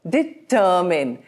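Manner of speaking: Determine slowly, di-TUR-min; stress on second syllable. Shown as a demonstration. Teacher showing where emphasis is, stressed second syllable. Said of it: "the emphasis is on T".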